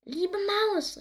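high, delighted cry to the mouse; high happy exclamation; joyful call. A narrator's reading. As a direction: turtle calling out in happy surprise. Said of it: "rief die Schildkröte".

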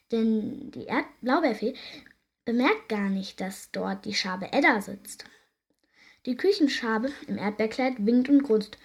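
ordinary delivery, nothing marked

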